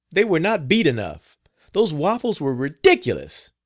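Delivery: high, loud angry scolding. A narrator's reading. Madam scolds the cook over her cooking, furious and loud. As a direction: berate the cook in a rage. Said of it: "in a rage".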